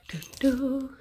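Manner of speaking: high, sing-song pet voice; pet talk. Real, not acted. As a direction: coax the bird gently. Talking to pet bird.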